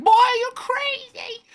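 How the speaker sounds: very high, loud, drawn-out Southern cry; mock a Southern friend's high holler; imitating Southern friend. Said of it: "he has a Southern accent".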